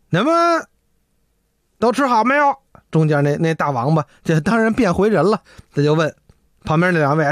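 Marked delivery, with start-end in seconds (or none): none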